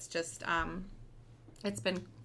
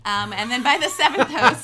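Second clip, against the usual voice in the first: loud, higher, laughing through the words; 6 semitones higher, 14 dB louder than usual. Real, not acted. Guest admitting to interviewer how her host network ran out, laughing. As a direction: let real laughter break into it. Joking about hosts, laughing.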